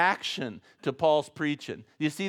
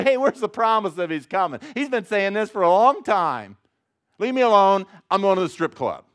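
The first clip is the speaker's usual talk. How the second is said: louder, higher sneering taunt; scoffing mocker; 6 semitones higher, 7 dB louder than usual. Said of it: "mockers come and say".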